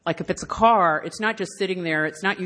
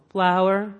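solemn, spaced-out poem recital; mock-poetic, with long pauses; poetry reading; slower than usual. Shown as a demonstration. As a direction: read each word like a precious line of verse. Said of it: "I'm gonna read this like a poem".

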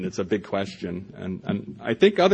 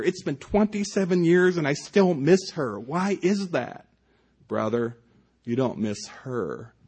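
reading his poem with a storyteller's lilt; 6 semitones higher than usual. Acted. Author reading his poem to the audience, storyteller's lilt. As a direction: let the lines land, read it like a tale.

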